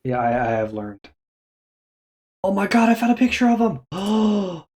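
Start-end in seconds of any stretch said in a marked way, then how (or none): 2.4-4.6 high, excited gasp of discovery; delighted surprise. Real, not acted. Finds troll picture.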